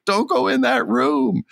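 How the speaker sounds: high-pitched warning at the TV; high, pleading mock scream. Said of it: "scream at the television screen".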